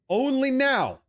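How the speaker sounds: ONLY NOW shot up high and punched; indignant hammer on "now"; emphatic stress. Real, not acted. Ranting, hammering his key phrase.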